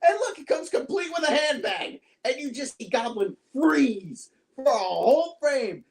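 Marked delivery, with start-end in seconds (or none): 0.1-2.0 mocking taunt. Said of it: "He's like".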